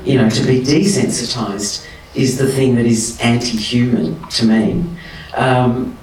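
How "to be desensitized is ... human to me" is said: ordinary delivery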